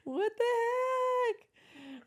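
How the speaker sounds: very high, long-drawn squeal of laughter; laughing disbelief. Real, not acted. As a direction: squeal it slowly through helpless laughter. Reacting to his admitted lie.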